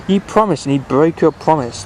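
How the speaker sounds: lightly voiced stern reproach; mild reproachful telling-off; sternly. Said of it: "man said sternly".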